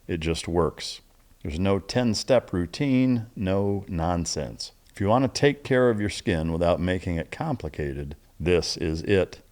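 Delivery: upbeat host ad read, selling a face cream. Acted. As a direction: pitch the product like a radio spot. Sponsor ad read.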